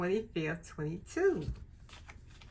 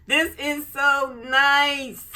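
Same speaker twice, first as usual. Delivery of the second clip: higher, louder, slow gush; real rising delight; excited gush; 8 semitones higher, 11 dB louder, slower than usual. Real, not acted. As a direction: stretch it out, thrilled. Gushing over the planner.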